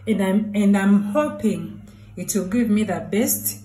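ordinary talk, nothing marked

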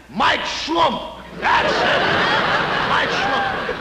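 high, loud eureka; triumphant shout. Acted. Boss discovering the new name, triumphant shout. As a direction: burst out with the name.

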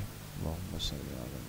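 soft, low prayer murmur under the breath; murmured recitation. Acted. Teacher leading students in quiet recitation, murmured softly. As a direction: recite it quietly to yourself, inward.

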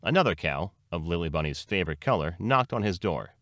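ordinary delivery

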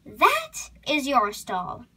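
"That is your stall" slowly, with THAT set apart; emphatic spelling-out. Acted. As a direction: lean on "That", then pause before "is your stall".

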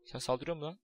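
ordinary talk

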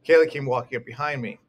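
plain reading voice

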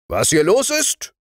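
high, rude echo question; gruff sergeant. Acted. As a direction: throw the question back roughly. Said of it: "fragte der Wachtmeister grob".

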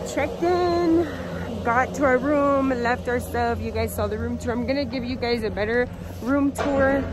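loud and high, very excited; thrilled first gondola ride. Real, not acted. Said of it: "so I'm super excited".

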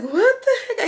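jumps way up in a high squeak of disbelief; a shrill-free but very high what the heck; squeaky mock shock. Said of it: "I was like".

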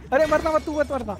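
panicked plea. Real, not acted. Panic as teammate nearly dies.

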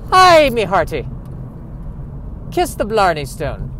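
loud, hammed-up fake Irish accent; bad Irish accent. Shown as a demonstration. Host defending his attempt at an Irish accent, badly faked. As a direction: launch high into a terrible Irish brogue. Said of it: "an attempt at an Irish accent".

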